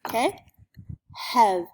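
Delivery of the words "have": loud clear have; pulled-up sounds. Shown as a demonstration. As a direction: push have out strongly. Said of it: "pull all the sounds up".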